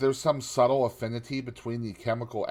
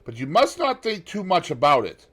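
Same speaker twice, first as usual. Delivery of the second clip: high, loud gentleman's voice, full of sympathy; Lord Henry; 9 semitones higher, 9 dB louder than usual. A narrator's reading. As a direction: lift the voice high and pour out the condolence. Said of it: "said Lord Henry".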